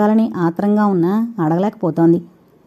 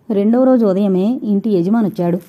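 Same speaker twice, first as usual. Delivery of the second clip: plain reading voice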